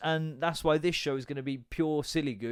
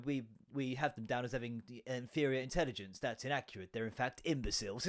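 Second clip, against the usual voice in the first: low, soft imitation of an old documentary presenter; hushed, lower mock-official explainer; fifties documentary voice; 4 semitones lower, 8 dB quieter than usual. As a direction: recite it quietly like a dated expert. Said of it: "it was, like, a nineteen fifties thing talking about".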